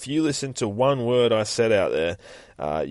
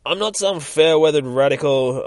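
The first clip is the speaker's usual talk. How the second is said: loud, higher-pitched retort; tearing him down; 7 semitones higher, 9 dB louder than usual. Acted. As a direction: tear into him as an acted put-down. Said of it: "she's fucking just tearing him down almost".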